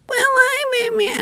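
high, whiny mock whimper of a shy comic; mock timid whimper. Host mocking a timid comic who froze, whimpering. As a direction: squeak it out like she's about to cry.

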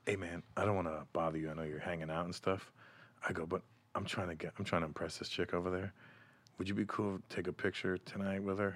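low, hushed murmur in someone's ear; creepily discreet, near-whispered request; creepy whispering. Retold or imitated. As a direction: keep it soft and low, a confidential plea. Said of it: "all in the creepy voice".

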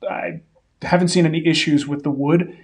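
ordinary delivery

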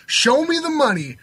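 catchphrase. Shown as a demonstration. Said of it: "Here it is".

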